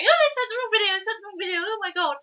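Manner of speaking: very high-pitched panicked squeal, hammed up; mock freaking out. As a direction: imitate someone in a flap, voice shooting way up. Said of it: "completely freaking out, going".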